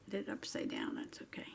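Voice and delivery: unvoiced whisper, shrugging it off. Real, not acted. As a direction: whisper it off to yourself. Muttering after a mistake.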